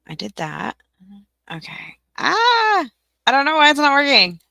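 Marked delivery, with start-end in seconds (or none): 2.2-2.9 high, loud wail of frustration; frustrated cry. Real, not acted. Stream setup keeps failing. 3.3-4.4 loud, high, fast complaint; exasperated wail. Real, not acted. Stream setup keeps failing.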